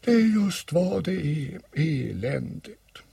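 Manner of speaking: high, slow, dismal character voice; gloomy donkey. Acted. Old donkey laments by the stream, gloomy. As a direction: lament it in a high voice, dragging the end. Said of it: "sa Ior dystert".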